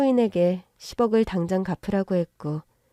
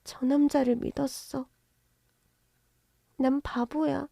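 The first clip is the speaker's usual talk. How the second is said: soft hurt confession; 5 semitones higher, 5 dB quieter than usual. Acted. Daughter confesses trust betrayed.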